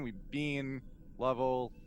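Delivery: ordinary delivery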